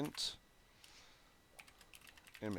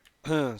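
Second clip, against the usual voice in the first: a rough throat clear; real throat clear mid-lesson; 6 semitones higher, 13 dB louder than usual. Real, not acted. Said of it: "super phlegmy tonight".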